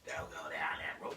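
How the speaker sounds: hushed, mocking caution; mock proper talk. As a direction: imitate a timid, proper warning under the breath. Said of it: "Y'all do that proper shit".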